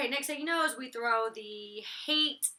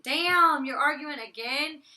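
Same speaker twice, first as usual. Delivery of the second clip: exasperated disbelief; 5 semitones higher, 9 dB louder, slower than usual. Retold or imitated. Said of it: "it's like".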